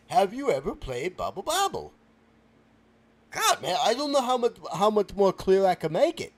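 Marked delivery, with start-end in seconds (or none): 3.3-6.3 exasperated. Acted. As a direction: complain loudly that nobody understands. Frustrated at not being understood.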